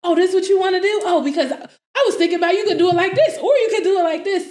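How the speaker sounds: high, fast, gushing ideas; eager brainstorming. Retold or imitated. Go-getter suggesting plans to someone, high excited rush.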